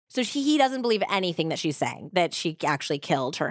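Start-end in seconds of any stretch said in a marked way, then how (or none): none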